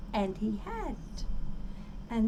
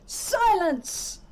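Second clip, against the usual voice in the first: barked command; 7 semitones higher than usual. A narrator's reading. The turtle king silencing Mac below, barked. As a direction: bark it down at him. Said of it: "barked back".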